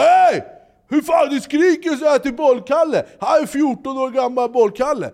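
hög, ljus upprörd skrikröst; skäll ut honom högt; angry yelling. Retold or imitated. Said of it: "ställer sig han ... upp och bara". (gubben skäller ut spelaren, högt och ljust)

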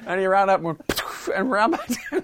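high, loud, breaking into laughter; helpless giggles between the words; laughing storytelling. Real, not acted. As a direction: tell it while cracking up. Cracking up telling smacked-teacher story.

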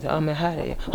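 normal talking voice

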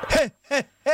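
high teasing cackle; mock cackle. Host teasing the guests with a cackle.

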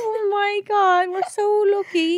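high, very slow mock Kerry; exaggerated high Kerry put-on; fake Kerry accent. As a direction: gush in a drawn-out bad Kerry accent. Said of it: "That's the worst Kerry accent".

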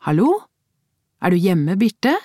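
calling into the flat; calling down the hallway. Acted. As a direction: call out with a rising question. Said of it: "ropte hun".

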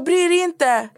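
high, squeaky mock voice of a boy hiding who he is; disguised boy voice. Teen troll answering her call, mocked in a high put-on voice. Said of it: "han gjorde till sin röst".

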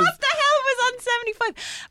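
high-pitched mock bewilderment; baffled outburst. Child puzzling over the ripped-out page, high baffled outburst. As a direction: blurt it out, pitched right up in disbelief.

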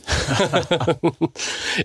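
bursts out laughing, high and bright; a sudden, genuine laugh at the joke; real laugh. Real, not acted. Laughter after joking remark. (aito naurahdus)

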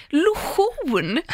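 "lotion" drawn out as lo-SCHOON; Swedish-style "lotion". Retold or imitated. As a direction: stress a long Swedish -schon in lotion. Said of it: "att ni uttalar det lotion". (försvenskat "lotion" med betoning på slutet)

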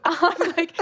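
ordinary talk

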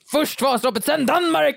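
högt, ljust triumferande skrik; stridsrop. Pretend. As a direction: ropa som en kung på väg att erövra. Kunglig stridsrop, Vasaloppet. (kungen ropar segervisst till sina män)

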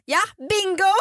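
high, jokey bingo holler; mock shout back. Customer shouting back at shop clerk, mock holler. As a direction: sing out a winner's cry in mockery. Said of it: "skriker bara tillbaka".

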